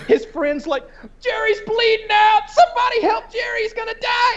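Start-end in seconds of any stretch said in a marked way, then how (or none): 1.2-4.3 much higher frantic cry for help; mock panicked shout. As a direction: yell for help like a cartoon alien. Friend voiced in retold scene.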